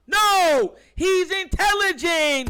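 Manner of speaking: a fan shouting back at critics, loud and high; loud rebuttal. Real, not acted. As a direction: shout down the doubters.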